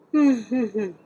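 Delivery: sudden loud high giggle; high laugh. Real, not acted. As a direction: break into laughter. Laughs mid-reading.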